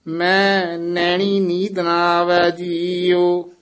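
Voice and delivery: continuous chant with no break after ਮੈਂ; scripture recitation. Shown as a demonstration. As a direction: run ਮੈਂ straight into the line. Line recited, then broken up. (ਬਿਨਾਂ ਰੁਕੇ ਪਾਠ)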